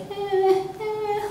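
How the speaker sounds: high, soft pre-cry whimper; baby's fussing. Retold or imitated. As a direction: imitate a baby about to cry. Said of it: "like she's gonna cry".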